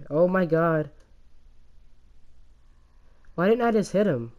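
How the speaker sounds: usual speaking voice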